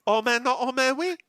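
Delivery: high, hammed-up French accent; mock French accent. Host answers the language joke, high French accent. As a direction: play up the French, pitched right up. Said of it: "my Amelie impersonation".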